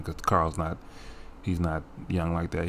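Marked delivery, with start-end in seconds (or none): none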